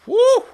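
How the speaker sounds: a sudden high, loud "woo" of excitement; gleeful storyteller's whoop. Acted. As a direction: whoop like the fuse just got lit. Narrator whoops at the big moment.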